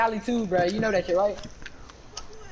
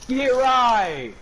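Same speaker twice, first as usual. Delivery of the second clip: loud, higher drawn-out cheer; triumphant yell; 4 semitones higher, 4 dB louder, much slower than usual. Real, not acted. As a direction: crow loudly over the play. Exulting over a play in game.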